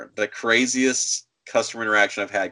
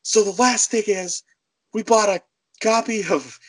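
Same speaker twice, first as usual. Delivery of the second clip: lightly voiced caller, higher; complaining customer; 9 semitones higher than usual. Woman starting her last complaint, mock aggrieved. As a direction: imitate her laying out a complaint, pitched up.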